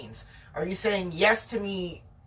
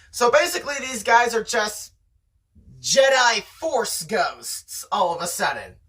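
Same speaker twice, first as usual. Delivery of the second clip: ordinary delivery, nothing marked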